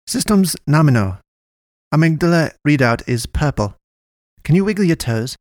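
high, stilted character voice running checks; odd little medic's voice. A narrator's reading. Miguel tests the patient who just came to, stilted.

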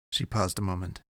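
plain reading voice

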